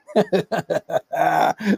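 a burst of rapid chuckles; long, drawn-out cackling laugh. Real, not acted. Host amused at his own dating advice, laughing.

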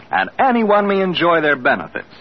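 ordinary talk

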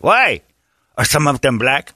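imitated worried Navajo player; Navajo accent. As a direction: ask in a mock Navajo accent. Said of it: "That's how the Navajo basketball".

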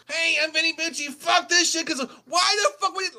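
very high, loud mock rant; hammed-up screeching angry tirade; Vinny Bucci pissed off. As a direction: imitate a podcaster blowing his top. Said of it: "you might hear Vinny get pissed off".

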